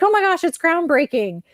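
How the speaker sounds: high, gushing mock amazement; mock gushing praise. Imitating readers who gush that the copied book is groundbreaking. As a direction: gush over it like an impressed fan. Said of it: "people would be like".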